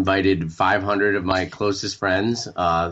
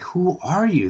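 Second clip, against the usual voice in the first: much higher, disbelieving; high, incredulous question; 9 semitones higher than usual. Retold or imitated. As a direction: pitch it up in disbelief. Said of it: "And they're like".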